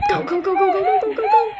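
high, excited chant of go; frantic cheering. Real, not acted. Player urging Melanie to run, very high excited chant.

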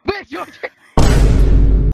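loud, slow mock announcer; drawn-out shout. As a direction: drag it out at full volume. Stretched out loud catchphrase.

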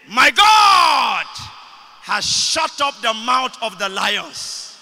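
ringing shout, drawn out; retold calling out. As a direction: call it out as from the lion's den. Voice calling from the den.

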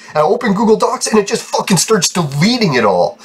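usual speaking voice

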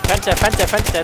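loud, urgent warning shout; urgent callout. Real, not acted. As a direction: call it out to the team. Calling out enemy in window.

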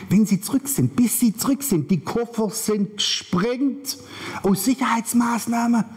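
high-pitched, slowing into deliberate words; emphatic warning. Retold or imitated. Warning travelers about abandoned suitcases, high and emphatic. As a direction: build upward and spell out the blast.

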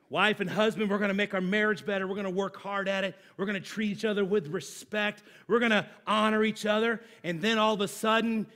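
usual speaking voice

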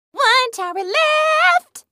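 high, slow, climbing call; giddy sing-song call. Acted. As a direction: stretch "left" upward, bursting with excitement. Countdown call, higher repeat.

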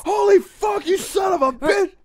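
very high, loud victim's yell; hammed-up wailing outburst; imitated shocked shout. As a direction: shriek it in mock shock. Acts out terrified victim's reaction.

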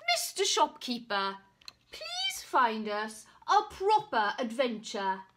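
loud, haughty demand; indignant demand. Acted. Knight demanding a better story, indignant. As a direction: insist with wounded pride.

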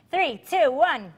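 high, loud contest countdown. Real, not acted. Hostess starting the baby food race, loud countdown. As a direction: call out each number to start the race.

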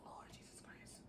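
breathless whispered exclamation; awed whisper. Real, not acted. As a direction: whisper it in disbelief. Awed at woman in towel.

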